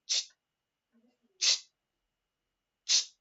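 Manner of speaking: short hissed "ch" of a fuse lighting; spark sound. Shown as a demonstration. Teacher shows class how cannon's spark went, imitated spark hiss. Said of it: "¿cómo hacía la chispa?".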